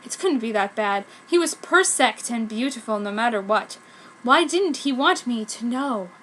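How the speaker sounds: ordinary talk, nothing marked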